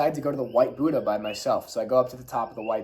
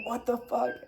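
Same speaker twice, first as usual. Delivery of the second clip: amazed disbelief; 11 semitones higher, 4 dB quieter than usual. Retold or imitated. Friends run up, Peleg amazed.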